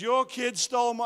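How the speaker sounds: high, loud indignant complaint; an angry stranger's accusation. Retold or imitated. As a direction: bark it at the father.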